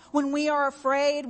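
ordinary talk

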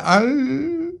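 high rising "oh" of dismay; hammed-up alarmed moan; mock wifely groan. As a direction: wail it like an embarrassed wife. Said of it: "She would have gone".

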